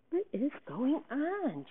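high, slow, disbelieving question; bewildered. Acted. Stunned friend at the dressing room door, bewildered. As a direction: stretch it out in astonishment. Stunned Via finds Miranda undressing.

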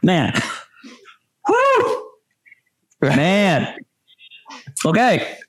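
gasping through burning hot spice; reacting to chili heat in the mouth; mouth on fire. Real, not acted. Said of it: "Oh, my mouth is on fire".

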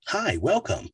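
put-on high, bright kids' channel promo; upbeat announcer. Shown as a demonstration. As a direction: sell it with a big smile. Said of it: "be more upbeat".

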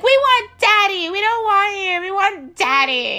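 put-on high, loud whiny kid chant; bratty kids. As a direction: whine like spoiled children. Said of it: "they're like".

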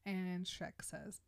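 ordinary delivery

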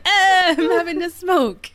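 high, loud mock French accent; caricatured French-Canadian voice; bad French accent. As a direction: ham up a terrible French accent. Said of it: "That's the worst French accent ever".

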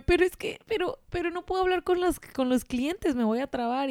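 high, anxious excuses; mildly panicked new hire; nervous protest. Retold or imitated. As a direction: protest in mock worry. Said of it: "Y yo".

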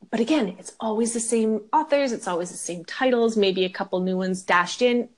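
usual speaking voice

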